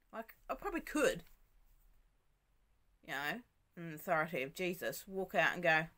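usual speaking voice

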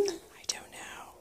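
soft breathy whisper. Real, not acted. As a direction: whisper it back gently. Whispering back to patient.

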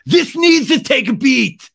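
high, loud, drawn-out yell; mock toddler meltdown; childlike tantrum. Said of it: "It's very childlike tantrum".

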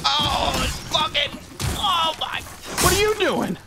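high-pitched exasperated yell; exasperated shout. Real, not acted. Gamer yelling at an opponent mid-fight, exasperated shout. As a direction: cry out in high alarm at the game. Panicked outburst during game fight.

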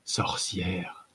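breathless, whispered accusation; winded whisper from an exhausted warrior; panting. A narrator's reading. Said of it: "haleta-t-il entre deux inspirations rauques".

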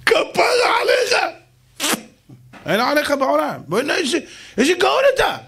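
king bursting with joy; overjoyed king. Acted. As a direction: praise the advisor with relief.

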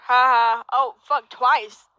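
ordinary talk

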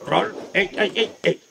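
quick run of repeated counts; speeded-up count. Shown as a demonstration. Instructor showing the nunchaku roll at speed, quick count.

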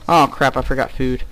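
normal talking voice

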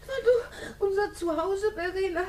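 crying, pleading voice; tearful. Acted. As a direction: hold back sobs. Dolly crying.

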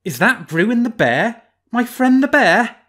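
high, loud mock-friendly fox; sly fox. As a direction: play the fox, teasing the bear.

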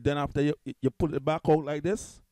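ordinary delivery

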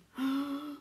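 big audible intake of breath; horrified gasp. Acted. Harold realises they are stranded, theatrical gasp. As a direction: gasp in mock horror.

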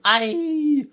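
very high, loud imp shriek; drawn-out high storybook scream; frightful shriek. A narrator's reading. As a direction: shriek piercingly as the thwarted imp. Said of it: "it shrieked frightfully".

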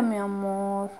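soft, hushed and loving; whisper-soft comfort; tender. Real, not acted. Mother calming daughter.

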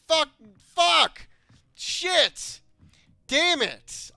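high, loud furious swearing; angry cursing. Real, not acted. Gamer cursing at a locked chest, furious yelling. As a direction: spit out each curse in rage. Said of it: "I'm mad".

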